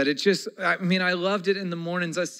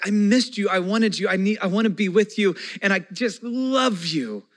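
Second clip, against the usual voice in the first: put-on higher, louder, eager child voice; gushing kid's welcome; 6 semitones higher, 4 dB louder than usual. Pastor voicing his children's welcome, eager and higher. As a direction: pour it out like an overjoyed child. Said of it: "it's like".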